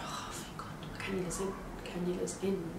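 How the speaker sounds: soft, half-whispered relief; hushed, relieved re-enactment; breathed relief. Retold or imitated. Relief once cannula is in, retold.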